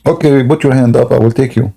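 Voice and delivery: ordinary talk, nothing marked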